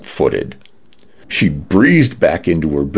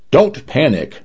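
mild shout; 7 semitones higher than usual. A narrator's reading. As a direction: call out an instruction to a swimmer. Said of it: "the lifeguard shouted".

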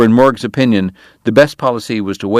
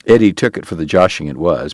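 ordinary delivery, nothing marked

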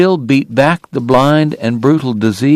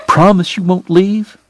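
high, insistent plea; tearful pleading; 7 semitones higher than usual. A narrator's reading. Sobbing woman seeking assurance from her lover, pleading. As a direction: ask for the promise in a high, pleading voice.

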